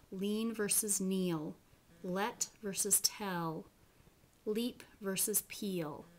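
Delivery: slow pairs, clear L first then dark swallowed L; light vs dark L. Shown as a demonstration. Teacher drilling light and dark L, slow. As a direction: spell out each light and dark L slowly. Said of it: "Do you hear the difference in those?".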